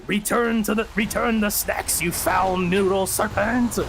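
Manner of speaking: drawn-out dramatic banishing; mock exorcist. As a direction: banish the beast with mock solemnity. Acting out banishing snake with crucifix.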